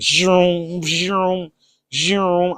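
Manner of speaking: drawn-out imitated lightsaber zooms; lightsaber hum. Patient picturing laser surgery as a movie, imitated lightsaber noises.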